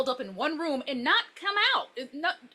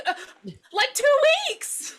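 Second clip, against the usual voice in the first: high, slow, drawn-out two weeks; sheepish answer; 13 semitones higher, 5 dB louder, slower than usual. Retold or imitated. Alice admitting how short she's known Greg, sheepish and high. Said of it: "how long have you been with him".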